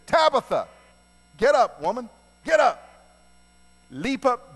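loud, high, emphatic summons; acted-out shout to rouse someone; calling out a command. Peter calls the dead woman up, loud command.

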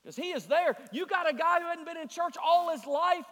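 rousing sermon delivery; impassioned preaching. Real, not acted. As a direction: preach it urgently to the whole congregation. Preacher at full force.

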